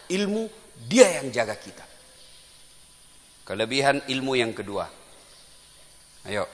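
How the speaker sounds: ordinary delivery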